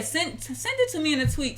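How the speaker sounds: usual speaking voice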